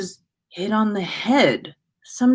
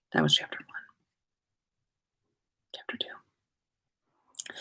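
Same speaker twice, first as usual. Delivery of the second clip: soft whisper, almost silent; whispered aside; 13 dB quieter than usual. Real, not acted. Reader whispering the chapter break to listeners. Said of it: "reader's aside between chapters".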